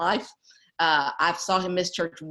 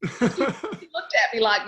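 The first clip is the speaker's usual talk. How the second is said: laughing; 5 semitones higher, much slower than usual. Real, not acted. Laughing while retelling.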